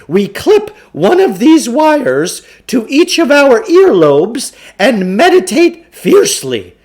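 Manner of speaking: pompous huckster lecturing his marks; Samuel Klugarsh voice. Acted. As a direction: play the self-assured mind-control huckster. Klugarsh's long pitch.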